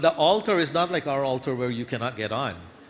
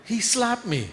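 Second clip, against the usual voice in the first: put-on child complaining; 9 semitones higher than usual. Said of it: "he said".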